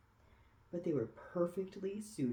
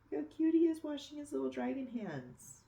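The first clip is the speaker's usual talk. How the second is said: high, louder cooing over a cute picture; cooing delight at the dragon washing his hands; cooing aside; 10 semitones higher, 4 dB louder, faster than usual. Real, not acted. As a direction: gush at how cute he is. Reader coos at picture.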